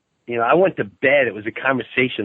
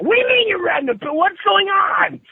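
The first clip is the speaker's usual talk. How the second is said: a high mocking rant of outrage; incredulous outrage. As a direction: shout your disbelief at him. Retold wife's outrage peaks.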